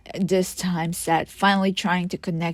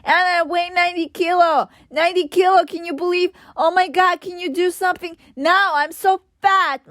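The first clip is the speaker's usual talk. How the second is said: high, loud panicked shrieking; shrieking cry; 11 semitones higher, 4 dB louder than usual. Retold or imitated. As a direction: wail it out, much higher, in horror. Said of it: "yelled in a shrieking cry".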